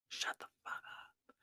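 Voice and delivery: softly whispered disbelief; stunned whisper. Retold or imitated. Reacting to the shaman's words, in a stunned, soft whisper. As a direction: breathe it out in shock.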